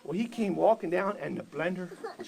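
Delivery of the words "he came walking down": ordinary delivery, nothing marked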